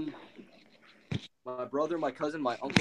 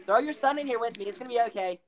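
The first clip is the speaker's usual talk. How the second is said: higher-pitched creepy coaxing voice; creepy babysitter; 5 semitones higher than usual. Retold or imitated. Imitating a woman's creepy chat offer to babysit, creepy coaxing. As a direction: imitate her creepy invitation. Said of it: "it's just creepy the way she said".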